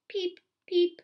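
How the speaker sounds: high little peeping chicks; chirping ducklings. A narrator's reading. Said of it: "out came six chirping ducklings".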